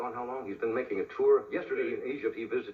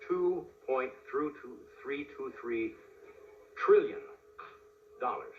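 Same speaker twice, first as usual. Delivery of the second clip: drawn-out reveal; 2 semitones higher, slower than usual. Real, not acted. Comedian reveals the debt ceiling to his audience, drawn-out reveal. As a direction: spell out the sum slowly, hitting trillion hard.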